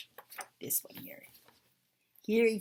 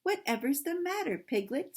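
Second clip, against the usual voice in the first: concerned question, starting high; lightly voiced Christopher Robin; 9 semitones higher than usual. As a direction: ask with gentle puzzlement, pitched up then settling. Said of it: "said Christopher Robin".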